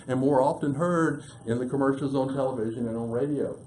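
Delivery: ordinary talk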